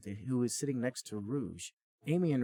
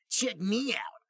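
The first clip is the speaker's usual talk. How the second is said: much higher cocky greeting; Sonic flirting; 12 semitones higher, 5 dB louder, slower than usual. Acted. Sonic showing off to Amy, high and cocky. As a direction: show off to her. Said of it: "said Sonic".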